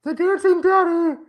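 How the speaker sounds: very high, strained clown voice; squeaky creepy clown line; Pennywise voice. Acted. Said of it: "that's my Pennywise".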